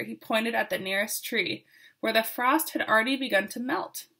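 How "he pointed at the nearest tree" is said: plain reading voice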